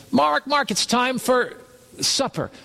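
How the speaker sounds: high sing-song call of a name across the house; wife calling out. Retold or imitated. Wife calls husband in from the car to supper, high call. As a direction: call him in for supper, stretched.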